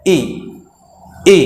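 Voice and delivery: ई as a very brief ih; short vowel sound. Shown as a demonstration. Teacher demonstrates the short sound of I, brief ई. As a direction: clip ई short, each on its own. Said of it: "short sound है".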